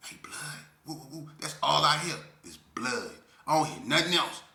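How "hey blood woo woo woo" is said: distant voices. Retold or imitated. Overheard voices outside the window.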